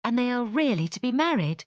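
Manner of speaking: high, wondering question; amazed cry. Acted. Elizabeth alone with Jane, amazed cry. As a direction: marvel aloud in disbelief. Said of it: "cried Elizabeth".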